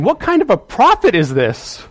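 incredulous, high-pitched rebuke; God reproving Jonah. Acted. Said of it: "I think God's saying".

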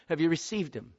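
ordinary talk, nothing marked

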